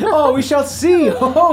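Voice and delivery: high, loud hearty retort; jolly father =63. Acted. Ghost father answering his son's boast with glee. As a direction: toss the boast back with glee.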